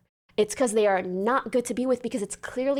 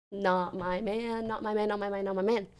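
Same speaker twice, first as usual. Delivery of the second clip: soft, repetitive muttering; low refusal; 3 semitones lower, 7 dB quieter than usual. Real, not acted. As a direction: chant it under your breath. Muttered refusal after the hypothetical.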